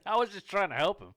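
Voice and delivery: high, loud put-on excuse; mock protesting neighbor.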